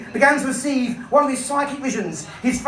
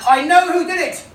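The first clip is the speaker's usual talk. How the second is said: triumphant shout; 4 semitones higher, 4 dB louder than usual. A narrator's reading. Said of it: "Inspector Riley leapt to his feet and shouted".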